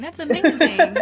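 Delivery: high, laughing wonder at the story; laughing in awe. Real, not acted. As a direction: laugh it out, amazed and moved. Moved host laughs in awe.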